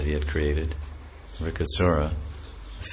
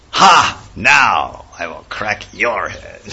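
gloating demon; 2 semitones higher, 8 dB louder, much slower than usual. Acted. Demon gloats at Shiva after getting the boon, shouting then slow. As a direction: crow in triumph, then drag out the threat.

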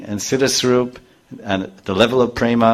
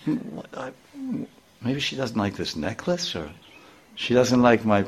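musing to himself; 8 dB quieter than usual. Retold or imitated. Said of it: "then Krishna said".

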